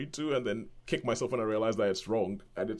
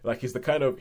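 ordinary delivery

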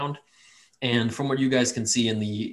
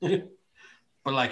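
ordinary talk

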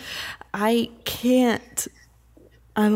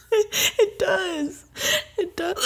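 laughing; 9 semitones higher, much slower than usual. Real, not acted. Laughing through hiccups.

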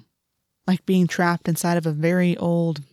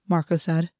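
ordinary delivery, nothing marked

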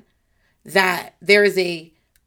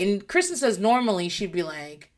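ordinary talk, nothing marked

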